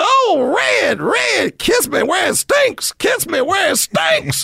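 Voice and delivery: put-on high, slow swooning girlfriend. Redd Foxx's joke girlfriend begging him, high and slow. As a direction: breathe it out, lovestruck and high.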